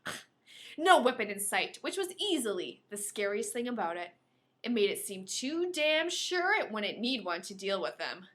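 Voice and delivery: ordinary talk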